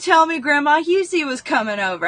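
put-on high, loud childish complaint; upset child. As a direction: demand it like a mad kid. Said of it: "I was mad at my mama".

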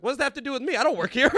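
loud and high, outraged; indignant shout. Retold or imitated. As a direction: fire it back indignantly. Indignant retort to the waiter.

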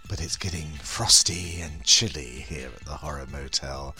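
sultry horror hostess welcome; femme fatale. Acted. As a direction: purr it like a vampy hostess. Horror hostess intro persona.

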